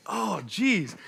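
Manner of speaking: mildly sheepish oh geez; a touch of retold embarrassment; embarrassed. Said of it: "it was very embarrassing".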